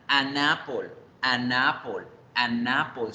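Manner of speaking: "anapol" as one word, the n linked to apple; seguido y fluido. Shown as a demonstration. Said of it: "Suena seguido, suena fluido, suena natural". ("anapol" seguido, natural, sin cortes)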